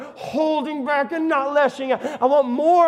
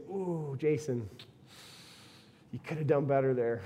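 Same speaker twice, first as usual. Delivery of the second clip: God, gently disappointed; 9 semitones lower, 12 dB quieter than usual. Retold or imitated. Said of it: "And he's saying".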